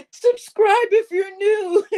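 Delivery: high and slow, strained through laughter; pushing the words out between laughs; laughing. Real, not acted. Asking new viewers to subscribe, laughing helplessly.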